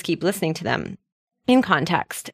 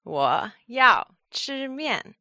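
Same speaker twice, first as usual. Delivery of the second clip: very slow, each syllable separated; slow repeat; 5 semitones higher, much slower than usual. Shown as a demonstration. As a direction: draw out every word for learners. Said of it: "a little slower".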